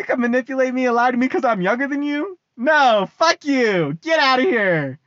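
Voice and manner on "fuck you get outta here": ordinary delivery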